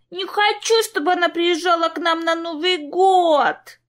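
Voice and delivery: put-on high, slow whining little girl; whiny spoiled pouty drawl. A narrator's reading. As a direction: whine like a spoiled toddler. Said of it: "закапризничала малышка".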